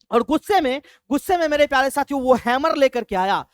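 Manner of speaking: plain reading voice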